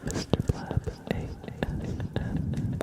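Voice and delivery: whispered letter by letter; ASMR whisper. Acted. Tracing a viewer's name letter by letter, whisper.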